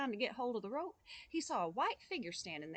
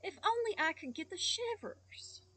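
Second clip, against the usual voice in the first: rising, longing to himself; wishful boy; 7 semitones higher than usual. Acted. Boy wishing to himself on the road, longing. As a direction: muse it aloud. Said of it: "saying to himself".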